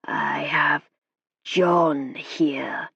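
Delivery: slow foreign spirit growl. A narrator's reading. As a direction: spirit guide speaking through the medium. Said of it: "growly voice".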